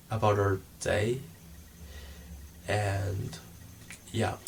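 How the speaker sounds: soft, slow guiding voice; meditation voice. Acted. Vlogger to viewers, starting a meditation, soft and slow. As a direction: ease them into a calm body scan.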